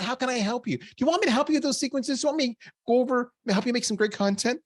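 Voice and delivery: high-pitched, imitated helpful colleague; eager offer of help. Voices marketing eagerly offering sales help.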